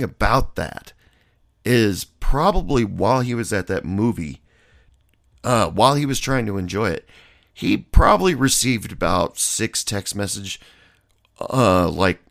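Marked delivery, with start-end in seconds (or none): none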